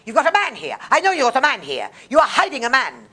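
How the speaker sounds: shouting furious accusations, voice up high; frantic jealous shouting; jealous accusing yell. Retold or imitated. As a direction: yell it like a jealous husband tearing the place apart. Jealous husband searching the flat.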